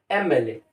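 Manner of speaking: "एम एल ए" opening cleanly on ए, no y; starts with an "e" sound. Shown as a demonstration. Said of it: "ऐसा".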